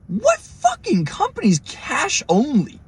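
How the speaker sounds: high, drawn-out disbelief; mock-outraged squeal at a demand; incredulous retelling. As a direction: stretch it out in high-pitched outrage. Retelling his disbelief at cash-only demand.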